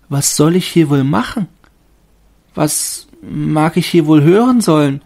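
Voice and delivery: hohe, langsame, staunende Baumstimme; Stimme des Baumes. A narrator's reading. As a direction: als ängstlicher Tannenbaum fragen. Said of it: "dachte der Baum". (hohe, zaghafte Baumstimme)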